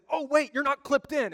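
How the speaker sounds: sudden high, loud cry of alarm; mock alarm. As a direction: imitate a prankster's panicked warning. Bungee operator's fake alarm, retold.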